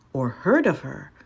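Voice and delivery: plain reading voice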